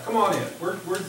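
ordinary talk, nothing marked

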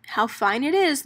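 usual speaking voice

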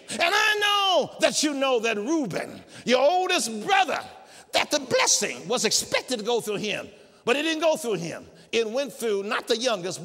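high, loud insistent preaching; forceful preaching. Acted. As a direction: drive it home loud and high. Preacher voicing Israel at full force.

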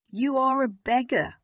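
getting angry. A narrator's reading. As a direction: throw it at the child in rising anger. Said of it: "getting angry".

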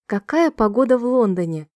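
ordinary talk, nothing marked

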